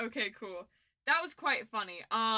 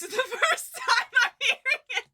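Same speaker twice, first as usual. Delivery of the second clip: high, loud, laughing disbelief; breaking into laughter; 13 semitones higher, 11 dB louder than usual. Real, not acted. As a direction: let real laughter overtake the words. Cracks up at the clip.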